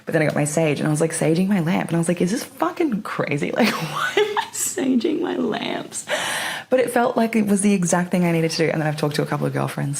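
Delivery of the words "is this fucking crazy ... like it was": laughing at herself. Retold or imitated. Laughing at her own sage ritual.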